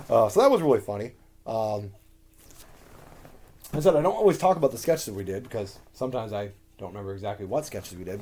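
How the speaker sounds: normal talking voice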